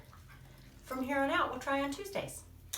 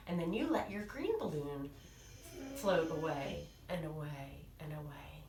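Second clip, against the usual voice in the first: plain reading voice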